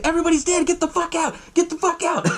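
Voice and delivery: high-pitched mock panic; panicked. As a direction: imitate a frantic warning to flee. Panicked survivors warning Tom.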